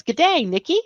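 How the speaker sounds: put-on imperfect Australian accent; attempted Australian accent. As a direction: have a go at an Australian G'day. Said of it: "didn't say that with the right accent".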